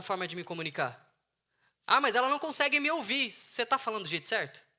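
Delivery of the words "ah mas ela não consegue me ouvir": whiny complaint. Retold or imitated. Mocking complaining husband.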